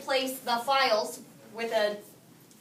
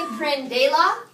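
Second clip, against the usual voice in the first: "prendela" as pren-DE-la, rising in question; wrongly shifted stress; 2 semitones higher, 6 dB louder, slower than usual. Shown as a demonstration. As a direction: push the weight onto DE in "prendela", doubtfully asked. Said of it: "change the pronunciation of the word".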